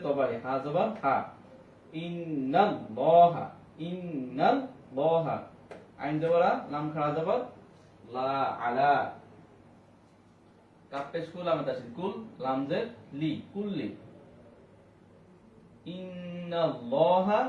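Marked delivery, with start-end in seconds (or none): 2.0-5.4 ইন্নাল্লাহা stretched slowly with held ল; slow model word. Shown as a demonstration. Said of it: "models the word slowly". 16.0-17.4 ইন্নাল্লাহা stretched slowly with held ল; slow model word. Shown as a demonstration. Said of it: "models the word slowly".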